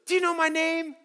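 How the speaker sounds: put-on high, teasing girl's question with a laugh; imitating a hopeful girl. Camper girl asks the pastor her name, high and laughing. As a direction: ask it like an eager kid testing you. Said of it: "And she goes".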